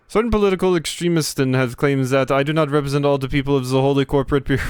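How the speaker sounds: put-on lightly held German bureaucrat accent; prim German official defending himself. Gamer voicing the German official claiming to represent the bureau, German accent. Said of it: "type of speech here".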